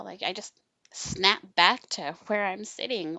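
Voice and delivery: ordinary delivery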